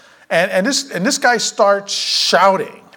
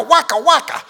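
high, gleeful whacka sound effects, a little louder; mock whacking noises; 9 semitones higher, 3 dB louder than usual. Preacher acting out David clubbing the lion with playful whacks. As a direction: bang out the club hits like a cartoon. Mimics club blows on lion.

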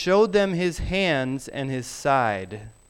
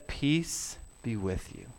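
tender; 4 semitones lower, 9 dB quieter than usual. Pretend. Jesus greets the frightened disciples.

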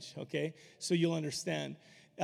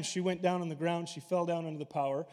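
normal talking voice

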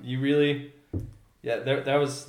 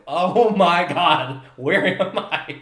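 slow, drawn-out wonder, a bit louder; awestruck; 4 semitones higher, 7 dB louder, much slower than usual. Retold or imitated. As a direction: savor it in disbelief. Sunset wildlife scene, awestruck.

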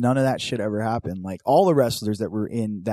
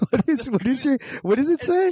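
high laughing disbelief; incredulous; 14 semitones higher than usual. Real, not acted. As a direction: ask it way up in disbelief.

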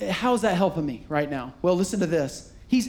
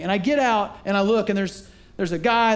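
ordinary talk

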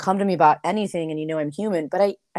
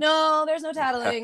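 slow, higher put-on parent voice; stern parent; 10 semitones higher, slower than usual. Parent telling child no tattling, slow and firm. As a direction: lay down the rule to the tattling kid. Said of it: "And I'm like".

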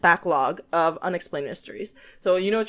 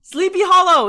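excited; 12 semitones higher, 8 dB louder than usual. Real, not acted. Sudden recall of a movie.

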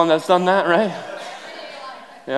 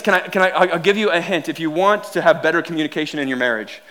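ordinary talk, nothing marked

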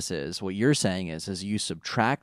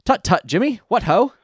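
mock British accent; 10 semitones higher, 8 dB louder than usual.

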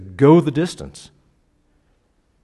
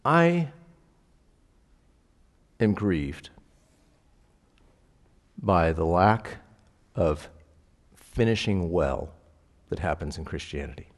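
slow, halting grief with long pauses; choked up; 4 semitones lower, 6 dB quieter than usual. Real, not acted. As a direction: hold back real emotion, sinking low and soft. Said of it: "I'd probably choke up".

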